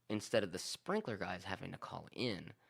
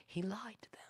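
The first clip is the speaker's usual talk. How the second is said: hushed, secretive aside; whispered aside; 12 semitones higher, 6 dB quieter than usual. Real, not acted. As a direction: lean in and whisper the scandal. Confiding aside about the lie.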